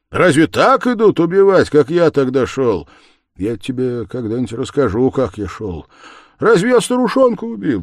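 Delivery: loud, high anguish sinking at the end; frantic murderer. A narrator's reading. Murderer telling Sonya he killed himself, frantic. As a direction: rail at yourself, then fall away spent. Acted outburst of self-condemnation.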